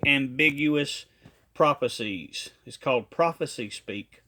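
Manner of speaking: ordinary talk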